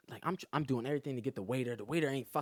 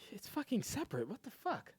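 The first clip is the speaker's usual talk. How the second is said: higher, softer mock exasperation; exasperated retort; 8 semitones higher, 9 dB quieter than usual. Telling the waitress the bill is separate, exasperated. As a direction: scoff it like it's obvious.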